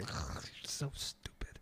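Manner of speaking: slow, hushed whisper; whispered jab. Real, not acted. Teasing co-host over his sitcom opinion, whispered. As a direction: whisper it slowly, very low.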